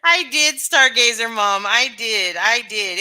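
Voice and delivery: loud, high, slow shout-out; excited greeting. Real, not acted. Streamer greets a chat viewer, loud and bright.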